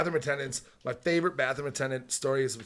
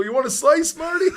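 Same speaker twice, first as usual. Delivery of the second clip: high, loud offer climbing at the end; Stuart; 13 semitones higher, 8 dB louder than usual. Retold or imitated. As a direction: chirp the question up like an eager attendant. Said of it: "I just hear Stuart's voice go".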